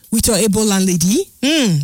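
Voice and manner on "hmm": ordinary talk, nothing marked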